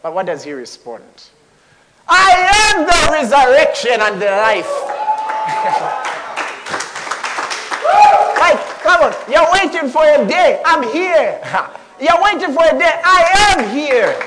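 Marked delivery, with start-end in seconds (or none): 2.1-4.7 a preacher roaring Jesus' claim; proclaiming shout. Acted. 4.8-8.3 a joyous cackle from the pulpit; laughing aloud with delight; high delighted laugh. Real, not acted. Joyful outburst after proclamation. 8.4-14.1 high, loud and eager; urging the crowd with excited joy; excited preaching. Real, not acted.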